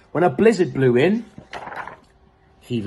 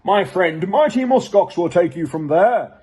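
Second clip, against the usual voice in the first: raised, louder walrus voice; grand walrus; 5 semitones higher, 4 dB louder than usual. Acted. Walrus telling Wilf of the muskox, grandly. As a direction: keep the proud proclaiming going. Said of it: "he proclaimed".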